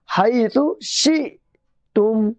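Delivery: slow शिअतुम with an abrupt stop after शि; jolted hamza. Shown as a demonstration. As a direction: break शिअतुम sharply at the hamza. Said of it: "झटके के साथ बोलेंगे". (शिअतुम को झटके के साथ)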